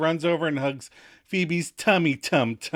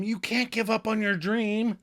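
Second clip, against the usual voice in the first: platitude; 8 semitones higher than usual. Retold or imitated. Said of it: "Phoebe platitudes at him".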